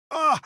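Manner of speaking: sharp high yelp of sudden pain; loud startled gasp of pain; pained cry. Acted. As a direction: cry out as the cramp hits. Sudden stomach pain after mushrooms.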